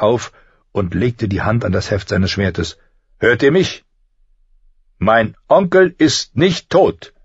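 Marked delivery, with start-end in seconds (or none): 3.2-7.1 high slow furious shout. Acted. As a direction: bellow it, echoing off stone. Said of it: "laut und voller Zorn".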